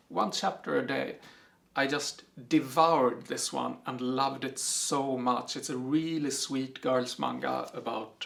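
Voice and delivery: normal talking voice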